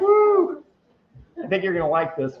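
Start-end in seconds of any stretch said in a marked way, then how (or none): 0.0-0.6 high, loud delighted whoop; excited whoop. Real, not acted. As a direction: let out a thrilled woo. Reacts to glowing glass.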